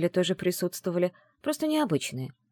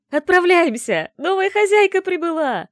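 loud, high, jubilant crowd cry; joyful yelling; 12 semitones higher, 9 dB louder than usual. Acted. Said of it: "Радостно завопила толпа посетителей".

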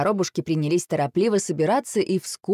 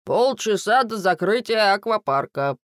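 guard's higher-pitched public announcement; loud announcement; 5 semitones higher than usual. A narrator's reading. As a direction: announce closing time to everyone. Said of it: "охранник и громко объявил". (громко объявляет)

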